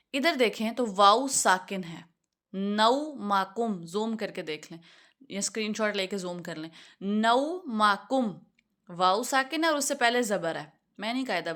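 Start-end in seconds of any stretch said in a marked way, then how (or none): 2.5-3.9 نوماکم said slowly, syllable by syllable; slow demonstration. Shown as a demonstration. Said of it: "پہلا word ہے نوماکم". 7.0-8.3 نوماکم said slowly, syllable by syllable; slow demonstration. Shown as a demonstration. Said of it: "پہلا word ہے نوماکم".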